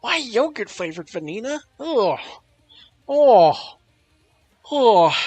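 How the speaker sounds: high, loud rising oh of real surprise; surprised reaction. Real, not acted. Streamer reacting to a chat message, loud and surprised. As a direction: caught off guard by the yogurt flavor, exclaiming.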